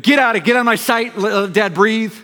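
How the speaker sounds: tired father shooing kids out, mock yell; frazzled dad yell. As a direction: snap at the boys to let Dad breathe. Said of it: "four boys".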